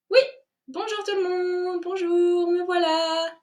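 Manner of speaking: high chirpy little voice; cheerful thumb voice. Acted. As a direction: chirp a happy hello. Thumb wakes and greets.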